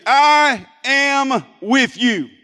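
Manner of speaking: very slow, stretched words for all to join; drawn-out, emphatic lead for the crowd; congregational call-out. Shown as a demonstration. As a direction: proclaim it word by word. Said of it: "say this with me".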